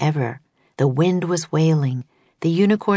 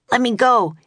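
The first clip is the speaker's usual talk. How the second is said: higher, struggling shout; 7 semitones higher than usual. A narrator's reading. Jack shouting at someone grabbing him. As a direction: shout while pulling free. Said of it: "he shouted".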